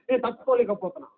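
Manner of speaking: soft, mock-weepy whimper; mock tearful complaint. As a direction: imitate someone hurt by a small remark. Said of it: "ఏడ్చేస్తుంటారు". (తట్టుకోలేక ఏడుస్తున్నట్టు మృదువైన నకిలీ స్వరం)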